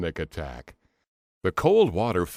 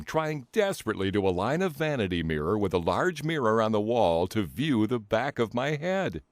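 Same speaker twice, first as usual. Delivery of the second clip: plain reading voice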